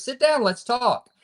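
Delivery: lightly voiced father, drawn out and up high; imitating his dying father. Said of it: "he goes".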